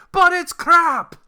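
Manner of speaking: loud, high, drawn-out Scottish shout. Retold or imitated. Imitating Mike Myers's TV Scotsman, hammed-up Scottish shout. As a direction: bellow it slowly as a caricature Scotsman. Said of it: "stereotypical Scottish guy".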